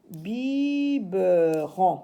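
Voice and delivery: BIBERON very slow, as BI-BE-RON; drawn-out syllables. Shown as a demonstration. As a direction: stretch each syllable of "Biberon". Teacher blends syllables into word.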